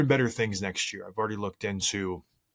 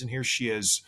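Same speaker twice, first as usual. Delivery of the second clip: ordinary delivery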